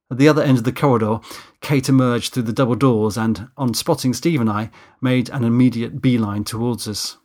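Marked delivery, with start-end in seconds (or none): none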